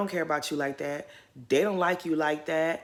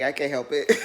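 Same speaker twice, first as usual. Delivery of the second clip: ordinary talk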